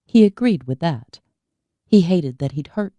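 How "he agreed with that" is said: ordinary delivery, nothing marked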